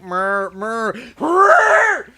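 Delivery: strained high murr, building loud; grunting murr, rising to a shout; constipated creature noise. Retold or imitated. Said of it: "the Calic constipated noise".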